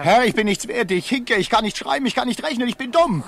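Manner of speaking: high, loud, frantic self-debasement; desperate pleading. Acted. Slave pleads to avoid sale.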